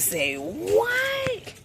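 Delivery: squeaky high-pitched disbelief; shocked say what. Retold or imitated. Retold self, spotting the item, gasping in a very high voice. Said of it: "I said".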